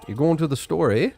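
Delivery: cheerful Canadian ask with eh tagged on; hammed-up Canadian accent; Canadian request. Shown as a demonstration. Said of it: "just throw the A in the back".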